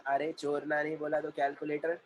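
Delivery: ordinary talk, nothing marked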